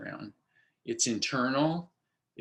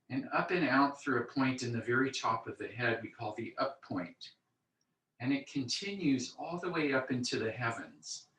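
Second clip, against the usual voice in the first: calm grounding exercise; 3 dB quieter than usual. Acted. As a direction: talk them gently into their body. Leads a standing visualization.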